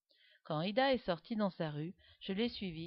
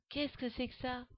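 startled; 6 semitones higher than usual. A narrator's reading. Seeing the apparition.

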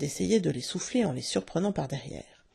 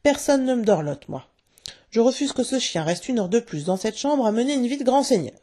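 higher, louder jealous tirade; indignant shouting; 5 semitones higher, 4 dB louder than usual. A narrator's reading. Father rails about the coddled dog, crying out indignantly. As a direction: cry out aggrieved, pitched up. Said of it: "cria-t-il".

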